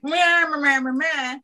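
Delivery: whiny dismissive meh sounds; mock a half-hearted effort. Mocking lazy half effort.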